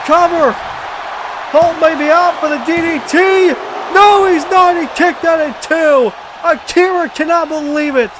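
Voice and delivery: excited play-by-play, rising pitch; calling the match live, pumped up; wrestling commentator. Acted.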